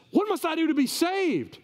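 high-pitched cry of the desperate jailer; acted desperate question; urgent plea.

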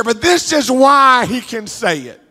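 usual speaking voice

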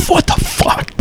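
cracked, breathy high cry; retold breakdown. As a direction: voice breaking into a sob on the words. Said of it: "I start just crying and shit".

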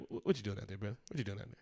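low, soft coach pulling a player aside; a hint of a stern but kind coach; quiet coach talk. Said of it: "pull somebody aside and like".

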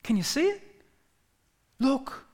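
God inviting his people to look, lifting excitedly; fatherly excitement. Retold or imitated. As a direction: voice lifting high on the question, eager. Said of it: "There's an excitement in that".

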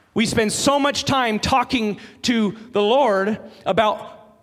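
rising sermon intensity; preaching. Acted. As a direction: press the point home to the congregation. Preaching at full force.

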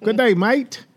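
high, hammed-up Aussie "mate"; mock Australian greeting. Husband joking for people overseas, mock Australian accent.

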